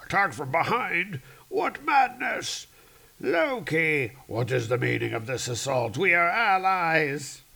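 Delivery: high outraged stagy cry; shocked storm god. Acted. Storm god betrayed by his ally, high melodramatic shock. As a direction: start on a yelp, then protest indignantly. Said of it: "He's like".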